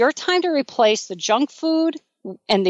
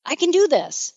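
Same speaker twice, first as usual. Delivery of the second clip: excited self-cheer; 6 semitones higher than usual. Retold or imitated. You, cheering yourself on after a hard win, excited and high. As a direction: pitch jumps up high on I did it, near a squeal of triumph. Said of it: "and you go".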